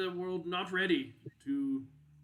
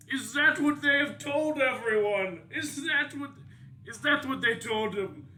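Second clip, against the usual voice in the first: amused disbelief; 9 semitones higher, 8 dB louder than usual. Acted. NPC amused disbelief at the rumor.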